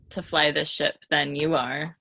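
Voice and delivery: usual speaking voice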